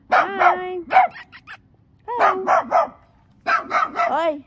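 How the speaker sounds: high, sing-song hi to a dog. Real, not acted. Vlogger cooing at her neighbour's angry dog, high baby talk. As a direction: coo it like talking to a puppy. Sweet-talking the barking dog.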